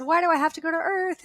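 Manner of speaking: high, quick tearful plea; crying. Retold or imitated. Asking the light beings why she must go, crying. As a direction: re-enact pleading through tears. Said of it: "I'm crying and crying".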